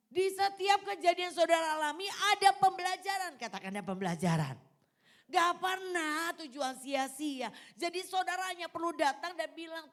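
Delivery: loud, high exhortation to the congregation; ringing, high-pitched pulpit call; full-force preaching. Real, not acted.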